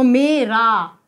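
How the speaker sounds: मेरा slowly, both syllables held long; sounded-out vowels. Shown as a demonstration. Teacher checking short or long sound, मेरा drawn out. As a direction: stretch मे and रा apart in मेरा. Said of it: "sound देखना है हमें".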